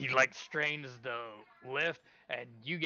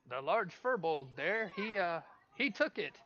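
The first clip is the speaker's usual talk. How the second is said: higher, slightly halting official; explaining announcer; 6 semitones higher than usual. Acted. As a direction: explain the result in a raised-pitch official voice.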